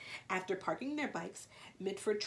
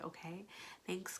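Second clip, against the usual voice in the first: usual speaking voice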